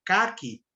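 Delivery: "caqui" with the stress on CÁ; stress on first syllable. Shown as a demonstration. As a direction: say "caqui" as CÁ-qui, light final i. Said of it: "refere-se a uma subcor". ("caqui" com a tônica no CÁ)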